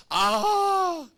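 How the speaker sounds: sharp, very high, loud "ah"; sudden high cry. Real, not acted. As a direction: let out a squeal of surprise. Reacting as the game ends.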